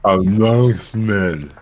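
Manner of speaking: very slow drawn out. Shown as a demonstration. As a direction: stretch every syllable very slowly. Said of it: "that is not what I said".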